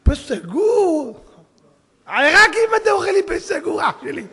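high, loud incredulous outburst; indignant mock shout. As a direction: call out the ridiculous claim at full voice. Mocking prim closed-mouth eating. (צעקת תדהמה מוגזמת)

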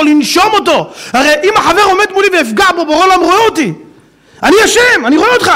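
high, loud, fervent sermon; preaching at full force. Acted. Preacher to congregation about divine judgment, fervent loud preaching. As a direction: rouse the congregation with awe.

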